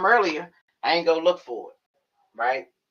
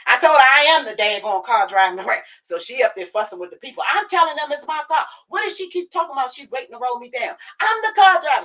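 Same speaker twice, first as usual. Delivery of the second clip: high, loud exasperated mom voice; imitating mom; 10 semitones higher, 5 dB louder than usual. Daughter plays mom fussing at hospital staff, high and loud. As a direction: squeal out mom's frustration.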